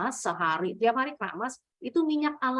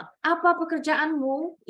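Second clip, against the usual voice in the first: higher pitch, lifting through "pekerjaanmu"; rising question intonation; 6 semitones higher than usual. Shown as a demonstration. Teacher shows how Indonesian questions sound, rising intonation. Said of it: "Intonasinya naik".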